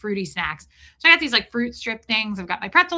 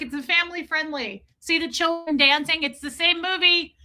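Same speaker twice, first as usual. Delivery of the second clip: higher-pitched pleading justification; mock desperation; 6 semitones higher than usual. Passenger proving the film is family friendly, mock desperation. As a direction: insist on innocence, a touch frantic.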